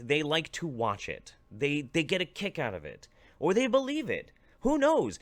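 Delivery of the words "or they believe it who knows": high, loud sarcastic toss-off; sarcastic high-pitched who-knows; mocking shrug. Real, not acted.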